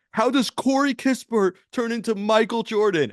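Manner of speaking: imitating a coach's loud, high-pitched yell; Tom Thibodeau. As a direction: holler in disbelief like a coach on the sideline. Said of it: "it got louder and louder".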